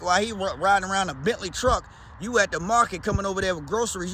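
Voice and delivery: ordinary talk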